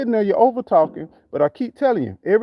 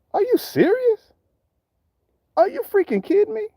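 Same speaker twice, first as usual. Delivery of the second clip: much higher, incredulous outburst; can't believe what he's hearing; exasperated disbelief; 14 semitones higher than usual. Real, not acted. Outraged rant at the politicians.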